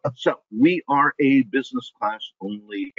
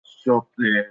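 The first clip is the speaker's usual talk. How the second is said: put-on French accent. French persona introduces himself.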